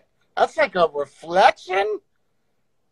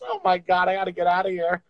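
ordinary talk, nothing marked

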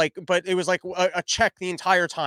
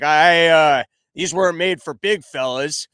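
lightly imitated raspy big biker, loud at the start; a hint of a raspy tough guy; raspy, manly voice; 7 dB louder, slower than usual. Huge biker grumbling about seats, lightly mocked raspy, manly voice. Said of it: "he had, like, a raspy, manly voice".

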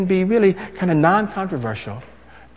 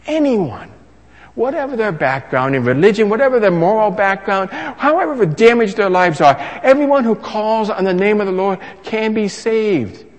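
rising, louder preacher's build; full-force preaching; 6 semitones higher, 5 dB louder than usual. Acted. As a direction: swell toward the promise.

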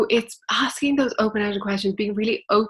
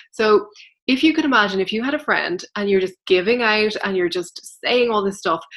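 usual speaking voice

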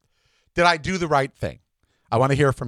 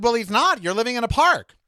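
high-pitched, exasperated comeback; exasperated retort; 9 semitones higher than usual. Retold or imitated. Telling Dexter off, exasperated and high. Arguing with Dexter about his plan.

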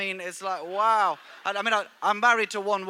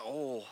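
soft mock sigh; 8 semitones lower, 10 dB quieter than usual. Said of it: "and they go".

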